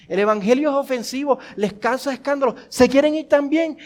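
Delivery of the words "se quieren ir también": louder, pushing challenge, daring them to leave; confrontational challenge. Acted. Jesus challenging the disciples to leave, louder and confrontational. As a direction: throw it at them like an open door. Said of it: "Es como si dijeran, la puerta está abierta".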